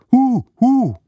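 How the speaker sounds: high, falling owl hoots. A narrator's reading. Owl in the fir tree calls out, high hooting. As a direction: hoot twice like an owl, high and hollow.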